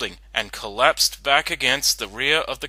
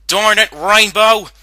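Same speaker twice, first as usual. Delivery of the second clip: loud, high shout into an empty store; high, loud frustrated shouting voice; 8 semitones higher, 10 dB louder than usual. Acted. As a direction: call out angrily after the runaway. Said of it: "Applejack shouted into the store".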